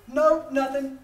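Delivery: loud quick brush-off; fast curt no. Retold or imitated. Brother shutting down the question, loud and fast. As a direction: snap it out fast. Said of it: "That's as fast as it comes".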